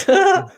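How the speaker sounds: sudden high, loud burst of laughter; squealing laugh. Real, not acted. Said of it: "laugh burst".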